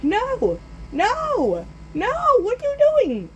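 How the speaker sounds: very high-pitched wailing protest; panicked cry. Retold or imitated. Boy seeing the tree set on fire, high panicked cries. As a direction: squeal each no in mock panic.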